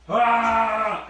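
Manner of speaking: sharp, high cry of pain; pained yelp. Real, not acted. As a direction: yelp as you stub your toe. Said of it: "I stubbed my toe".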